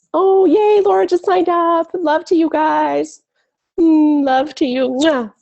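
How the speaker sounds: high, loud, gushing joy; squealed, drawn-out affection; delighted cheer. Real, not acted. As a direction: celebrate a new sign-up. Delighted at a new sign-up.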